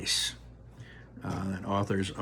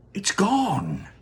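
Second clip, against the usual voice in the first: raised excited cry; Grandpa Joe; 11 semitones higher, 4 dB louder than usual. Acted. Grandpa Joe cries out to everyone, excited. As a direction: gasp out the news.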